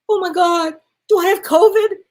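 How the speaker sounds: high, loud panicked question; dramatized burst of panic; retold alarm. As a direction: act out the alarm, pitch jumping up.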